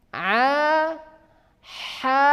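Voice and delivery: A and ha from mid-throat, light; light ain and ha. Shown as a demonstration. Teacher demonstrates ain and ha. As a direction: voice A then breathe ha. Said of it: "bagaimana cara mengucapkan".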